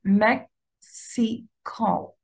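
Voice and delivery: مكسيكو slowly as mek, si, ko with gaps; three drawn-out isolated syllables; split into syllables. Shown as a demonstration. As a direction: sound out each syllable separately. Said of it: "نفس الشيء".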